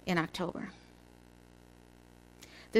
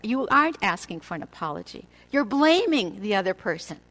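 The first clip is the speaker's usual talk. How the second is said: ordinary delivery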